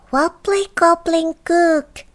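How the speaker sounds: high goblin incantation; goblin magic word. Acted. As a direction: cast a spell in a high goblin voice. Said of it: "he said a magic word".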